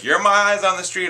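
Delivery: high, loud heroic quote; Spider-Man. Retold or imitated. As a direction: imitate a superhero calling out to someone he saved. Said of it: "Spider-Man swinging and he kinda saves Electro".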